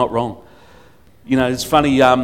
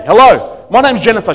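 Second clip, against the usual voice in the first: imitating a bursting-in hello, higher and louder; bombarding introduction; 5 semitones higher, 6 dB louder than usual. Jennifer bursting into the office, mock-bombarding hello. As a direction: barge in with your name. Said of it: "she just bombarded away and bang".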